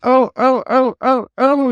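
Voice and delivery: high squealing cries; panicked little trucks squealing; screaming trucks. Acted. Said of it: "screamed the trucks".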